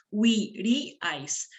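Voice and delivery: "huiríais" slowly split as hui-rí-ais; "huiríais" in slow, separate syllables. Shown as a demonstration. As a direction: draw out each syllable of "huiríais". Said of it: "Vamos a silabear".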